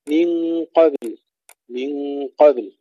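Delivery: slow من قبل, bounced ba, clear still lam; long nasal n, then echo the ba in قبل; qalqala on ba. Shown as a demonstration. Teacher models من قبل, keeping the ba's bounce. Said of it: "المحافظة على قلقلة الباء".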